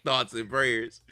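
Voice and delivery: slow, mock solemn Thoughts and prayers. Best friend mock-offers thoughts and prayers, slow and solemn. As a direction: drawl Thoughts and prayers like a fake condolence. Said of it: "Be like".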